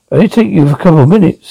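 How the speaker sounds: ordinary delivery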